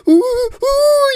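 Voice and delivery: very high excited squeals; mock girlish squeal. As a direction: squeal like a little girl off to the mall. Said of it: "you sound like a little girl getting excited".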